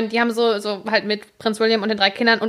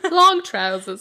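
put-on British accent; much slower than usual. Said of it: "mit so einem britischen Akzent sagen".

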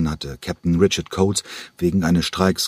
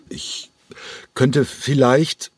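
hesitant; 7 semitones higher, much slower than usual. Acted. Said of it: "eine verhaltene Männerstimme ertönte".

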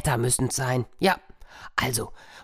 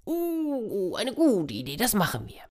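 animals agreeing; 7 semitones higher, 4 dB quieter than usual. Acted.

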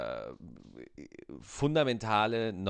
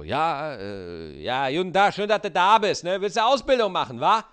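loud, much higher mock Berlin boss voice; gruff Berlin trainer; 6 semitones higher, 8 dB louder than usual. Berlin trainer loudly greeting the new apprentice. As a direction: bellow it like a pushy Berlin trainer. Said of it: "dann sagt er da so".

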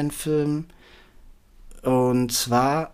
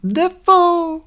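Dafoe squealed very high and loud; high squeaky shout; 6 dB louder than usual. Pretend. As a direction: shriek the name up high. How I Met Your Mother bit.

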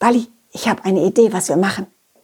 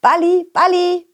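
higher, lightly raised call to the ball; 8 semitones higher than usual. Retold or imitated. Narrator calls to Balli while leading the donkey, playful call. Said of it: "rief ich".

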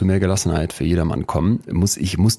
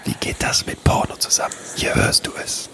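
whispering; 3 dB quieter than usual. Acted. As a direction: whisper close to the mic. Whispers again.

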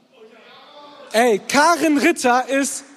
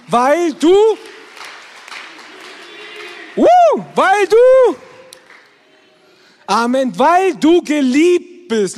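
preaching shout; 4 semitones higher, 7 dB louder, slower than usual. Real, not acted. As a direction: shout it out, slow and heavy. Preaching climax to crowd. (lautstark gepredigt, langsam)

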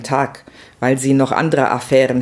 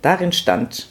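ordinary delivery, nothing marked

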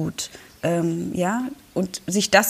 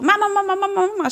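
put-on high whiny child calling mama; nagging small child; 12 semitones higher, much faster than usual. Small child tugging at mum's apron, high insistent whine. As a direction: tug at her sleeve, whining high.

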